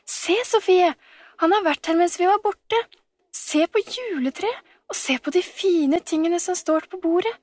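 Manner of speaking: put-on high-pitched, thrilled child-like discovery; excited calling out. A narrator's reading. Camilla showing Sofie the presents, calling out excitedly. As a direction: call out in delight, pitched well up. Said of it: "akkurat da ropte Camilla".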